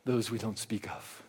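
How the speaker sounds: low and hushed, almost a whisper; hushed horror-movie murmur; eerie hush. Pretend. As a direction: murmur it like a spooky legend. Echoing the spooky phrase.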